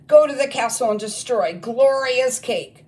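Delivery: high, loud barked war orders; a witch headmistress giving attack orders to her goblins; commanding witch. Acted. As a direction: order the troops like a furious witch queen. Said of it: "she bellowed".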